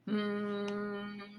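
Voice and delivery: long, soft sustained hum; robot snore. Acted. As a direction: snore as a drawn-out mechanical drone. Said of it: "snored Robot".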